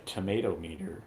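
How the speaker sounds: ordinary delivery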